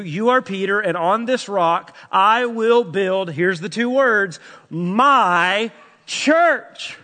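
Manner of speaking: emphatic preacher; fervent preaching. Acted. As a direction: drive the question home. Preaches at full force.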